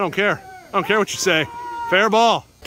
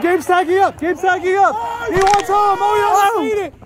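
excited play call; 6 semitones higher, faster than usual. Real, not acted. Runner tagging up.

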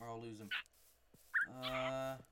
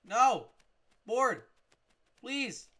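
loud, high, separate cries; desperate calling out; 7 semitones higher, 13 dB louder, much slower than usual. Real, not acted. Player calling his pet back, shouted pleadingly.

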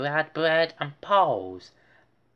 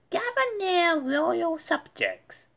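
grand announcement. A narrator's reading. Muffy announcing to her guests as princess. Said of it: "Muffy announced".

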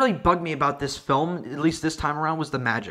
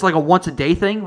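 usual speaking voice

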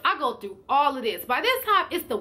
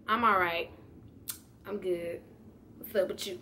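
much softer, cool and detached; acting uninterested; 5 semitones lower, 8 dB quieter than usual. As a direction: play it cool, barely bothering. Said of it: "I'm acting like I don't wanna talk to her".

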